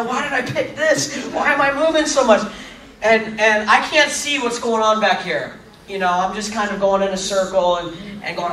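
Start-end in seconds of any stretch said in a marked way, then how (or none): 0.0-2.5 inner lament. Retold or imitated. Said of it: "And inside".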